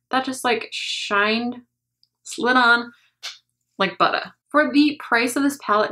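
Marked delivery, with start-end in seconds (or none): none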